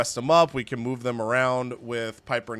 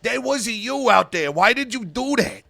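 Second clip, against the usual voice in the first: higher-pitched, pleading disbelief; upset wrestler Brooks Jensen; 7 semitones higher than usual. Acted. Loyal wrestler confronts his boss backstage, upset and incredulous. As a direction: question your boss as if let down by him.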